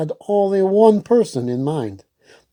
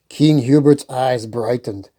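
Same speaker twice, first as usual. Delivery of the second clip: usual speaking voice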